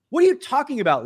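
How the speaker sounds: high, loud retold protest; retold indignant comeback; incredulous retort. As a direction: fire back at an accuser, baffled. Acted retort in the quarrel.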